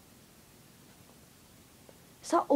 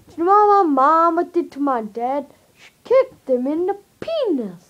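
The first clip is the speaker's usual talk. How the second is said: loud; 4 semitones higher, 9 dB louder than usual. Acted. Loud joke voice to camera.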